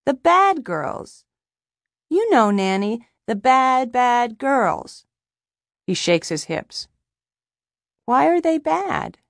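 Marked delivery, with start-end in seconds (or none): 0.1-5.1 high child voice, stretching BAD; insistent little boy. A narrator's reading. Boy explaining to his nanny. 8.1-9.2 put-on high, slow child voice; questioning little boy. A narrator's reading. Boy questioning his nanny.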